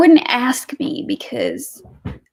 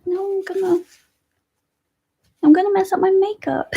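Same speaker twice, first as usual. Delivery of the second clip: much higher, cracking with real tears; tearful, voice breaking; 10 semitones higher than usual. Real, not acted. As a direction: choke up, touched by a gift from a viewer. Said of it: "you're gonna make me cry".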